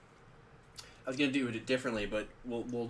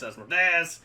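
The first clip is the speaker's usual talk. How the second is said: Asmodeus called out high and loud; loud dramatic Asmodeus; 6 semitones higher, 9 dB louder than usual. Shown as a demonstration. Playing with saying the name.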